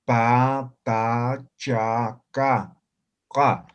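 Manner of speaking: "Pa, ta, cha, ca, ca" pronounced as in Spanish, unaspirated; separate, plain Spanish-style stops on "Pa, ta, cha, ca, ca"; simple consonants. Shown as a demonstration. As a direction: demonstrate "Pa, ta, cha, ca, ca" one syllable at a time. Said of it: "La simple se pronuncia igual que el castellano".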